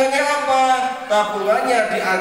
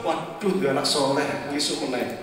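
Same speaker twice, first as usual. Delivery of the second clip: much lower and softer grumble; disappointed angel aside; 9 semitones lower, 7 dB quieter than usual. Acted. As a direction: mutter it, defeated. Angel gives up, muttering. (gumam malaikat yang kecewa, pelan dan rendah)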